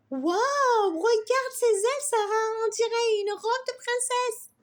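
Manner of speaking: put-on high, loud little girl's wow of wonder; émerveillée. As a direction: gasp at the butterfly's wings, delighted. Fillette émerveillée par le papillon. (petite fille émerveillée, voix aiguë et forte)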